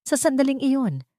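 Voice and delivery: plain reading voice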